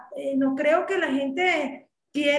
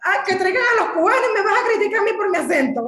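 raised, fast, outraged comeback; indignant retort; 5 semitones higher, 6 dB louder, faster than usual. Retold or imitated. Retorting to a critic who mocked her accent, indignant and loud. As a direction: fire back at the hypocrite, louder and quicker. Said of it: "Y yo".

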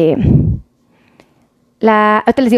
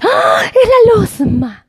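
excited cry; 8 semitones higher, much slower than usual. Retold or imitated. Friend spotting the actress.

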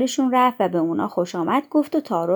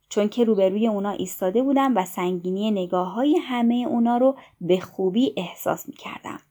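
ordinary delivery, nothing marked

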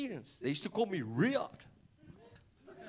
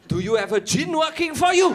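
loud, alarmed high-pitched question; alarmed shout; 11 semitones higher, 10 dB louder than usual. Retold or imitated. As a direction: blurt it out in alarm.

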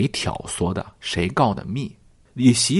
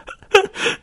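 high squeal of laughter. Real, not acted. Laughing at Xiren choking.

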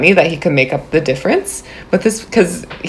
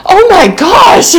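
stunned realization; 14 semitones higher, 4 dB louder, slower than usual. Retold or imitated. Husband sees artist waving.

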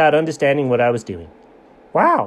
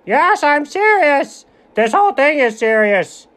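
a slight sitcom-comic emphasis on serious; unintended hint of a Seinfeld bit; Jerry Seinfeld; 8 semitones higher than usual. Real, not acted. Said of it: "You sound a little bit like Jerry Seinfeld there".

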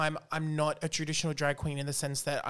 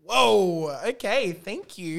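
stunned thanks; 4 semitones higher, 6 dB louder, much slower than usual. Retold or imitated. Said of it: "And I was like".

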